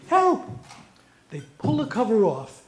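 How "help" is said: high, loud squawked scream; screaming parrot. Acted. Female parrot screaming help, high and loud. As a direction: screech for help. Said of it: "the female is screaming".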